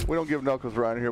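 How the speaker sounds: usual speaking voice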